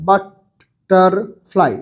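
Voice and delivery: butterfly stretched out syllable by syllable. Shown as a demonstration. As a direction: break butterfly into its syllables slowly. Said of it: "syllable ভাগ করে পড়তে বলছে". (butterfly ভেঙে ভেঙে সিলেবলে বলা)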